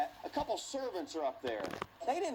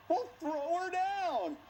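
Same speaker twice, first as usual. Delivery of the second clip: commanding king; 12 semitones higher, slower than usual. Retold or imitated. Jehu calling up to the servants, slow command. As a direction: drag out the command.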